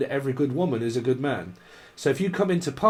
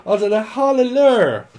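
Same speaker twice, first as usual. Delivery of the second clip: mock hallelujah; 9 semitones higher, 8 dB louder than usual. Mock spirit voice, laughing.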